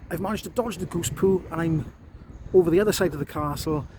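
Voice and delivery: ordinary talk, nothing marked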